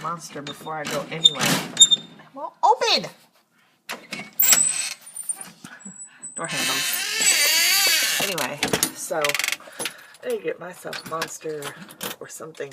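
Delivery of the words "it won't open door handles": strained complaint. Real, not acted. Struggling with door.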